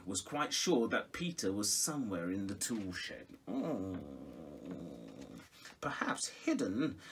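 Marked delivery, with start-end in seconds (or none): none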